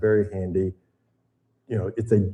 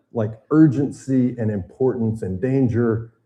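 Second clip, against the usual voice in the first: ordinary talk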